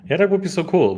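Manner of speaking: high, bright burst of enthusiasm; light up at the idea; excited. Real, not acted. Excited reaction to weather gear.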